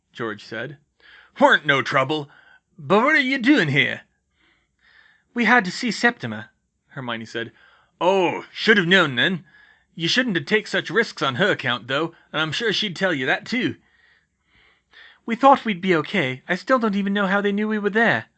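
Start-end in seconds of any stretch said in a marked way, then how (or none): 1.4-13.7 loud rustic big-man voice, pitched up; big gamekeeper, fretting; Hagrid, gruff and concerned. Acted. 15.2-18.3 put-on high, louder young woman's voice; earnest, troubled girl; Hermione, earnest.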